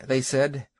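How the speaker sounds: plain reading voice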